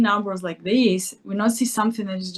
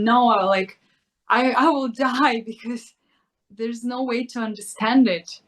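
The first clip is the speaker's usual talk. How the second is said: higher-pitched mock protest, not truly screaming; mock freak-out; 3 semitones higher than usual. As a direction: play at panicking, with a laugh breaking in. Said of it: "screaming and saying".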